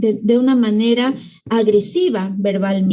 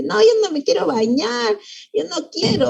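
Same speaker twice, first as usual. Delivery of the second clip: put-on higher-pitched whiny refusal of a little kid; child's bath-time protest; 7 semitones higher than usual. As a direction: imitate a child kicking up a fuss. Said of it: "A veces hace todo un escándalo".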